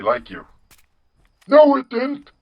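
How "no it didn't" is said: put-on indignant, very high old-man voice; John Henry. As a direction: protest in a cartoon old-man falsetto. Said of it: "you have the generic old man voice".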